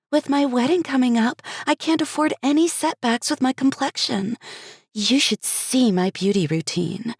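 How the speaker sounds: put-on high, bubbly young woman; bubbly bride-to-be chatter; Cherry's voice.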